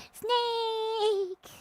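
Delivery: high drawn-out shout of Snake!; Snake impression. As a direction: wail the name like a game death cry. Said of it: "solid Snake impression".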